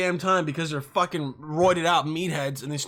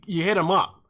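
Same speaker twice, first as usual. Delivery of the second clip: ordinary delivery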